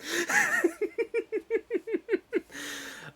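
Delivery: long high giggling fit; real giggle. Real, not acted. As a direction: giggle helplessly, high-pitched. Laughs after whispering bit.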